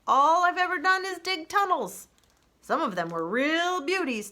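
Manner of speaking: put-on crusty old groundhog; old groundhog speech. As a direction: preach by the fire like an old-timer. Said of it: "old groundhog ... fireside speeches".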